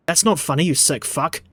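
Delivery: ordinary talk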